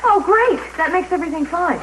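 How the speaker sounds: high, loud sarcastic approval; exasperated sarcasm. Acted. Girl answers his excuse about borrowing money, exasperated sarcasm. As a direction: throw back mock approval, voice jumping up.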